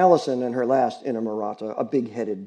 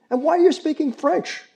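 loud, much higher demand; 6 dB louder than usual. Acted. Roy demanding the girl explain herself, loud and sharp. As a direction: demand an answer. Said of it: "I demanded".

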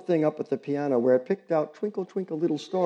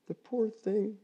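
soft murmur, pitched up; 10 semitones higher, 6 dB quieter, slower than usual. Acted. Grieving neighbor murmuring over her pet, soft. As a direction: murmur it with pity. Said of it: "she murmured".

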